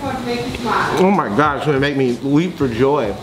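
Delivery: ordinary delivery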